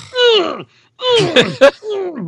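pained squeal. Retold or imitated. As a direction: squeak through closed lips as hair is pulled. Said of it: "And I'm like".

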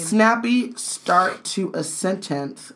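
slow, drawn-out reading; slow emphatic reread. Shown as a demonstration. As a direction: drag the line out deliberately. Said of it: "can we get back to this sentence".

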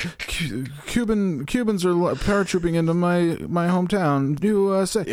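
high, hammed-up panicky voice; panicked rally fan. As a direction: blurt it like a panicked patriot.

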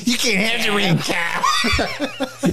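high, laughing cartoon brat; high laughing Cartman taunt. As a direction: taunt Kyle like a spoiled kid. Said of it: "All right, Eric Cartman".

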